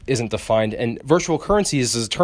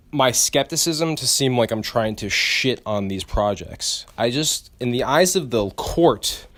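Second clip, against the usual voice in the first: ordinary talk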